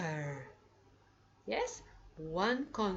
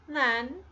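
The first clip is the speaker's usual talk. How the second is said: than said high and loud; punched key word; 6 semitones higher, 7 dB louder than usual. Shown as a demonstration. Teacher to class, than stressed high and loud. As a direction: hit than hard for the class.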